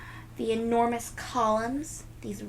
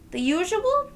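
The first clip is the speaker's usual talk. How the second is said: high, bright-voiced question; friendly vendor offering her regular; cheery vendor; 10 semitones higher, 5 dB louder than usual. Vendor asking Nellie if she wants her usual, high and cheery.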